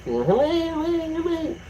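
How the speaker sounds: high, slow sing-song hellos; drawn-out high hellos from the counter lady; imitated sing-song greeting. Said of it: "the lady behind the counter".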